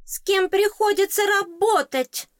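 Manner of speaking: very high, loud squeaky exclamation; high shrill fairy exclaim. A narrator's reading. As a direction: cry out to the heavens as a tiny fairy. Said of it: "воскликнуло существо".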